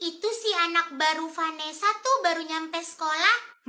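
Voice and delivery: normal talking voice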